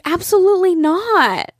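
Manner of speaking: mock the clerk stretching it out emphatically; imitating the clerk's firm, drawn-out refusal; emphatic clerk. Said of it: "She's like".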